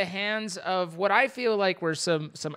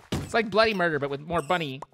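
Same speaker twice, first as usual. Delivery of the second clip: ordinary delivery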